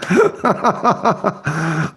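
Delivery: drawn-out laugh. Real, not acted. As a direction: keep laughing, easing off a little. Keeps laughing at the joke.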